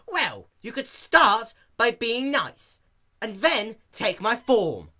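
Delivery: slow, high, loud pointed comeback; retorting. A narrator's reading. Dash scolding the rude clerk, slow loud pointed. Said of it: "not wanting to put up with the clerk's attitude".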